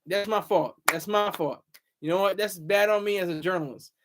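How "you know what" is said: ordinary talk, nothing marked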